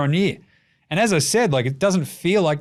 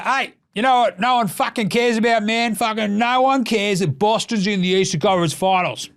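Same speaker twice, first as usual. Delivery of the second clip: higher, brash mock Draymond voice; mocking Draymond Green; 7 semitones higher than usual. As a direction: ham up a smug loudmouth athlete. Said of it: "He's like".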